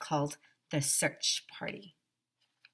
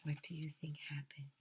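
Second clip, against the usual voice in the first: usual speaking voice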